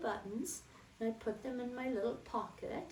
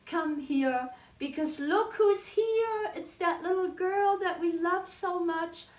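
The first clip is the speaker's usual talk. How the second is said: put-on much higher and louder, gushing old baker lady; sweet old lady greeting; 8 semitones higher, 6 dB louder than usual. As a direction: coo over the little girl with delight. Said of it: "And then she'd say".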